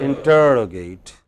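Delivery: "Interrogate" slowly, with a strong TER; stress on TER. Shown as a demonstration. Teacher confirming how we pronounce it, stress on TER. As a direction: stretch and stress the second syllable of "Interrogate". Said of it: "two syllables before".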